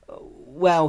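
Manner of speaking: hesitant halting well; stuttering. Acted. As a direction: stammer it out nervously. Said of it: "he stuttered".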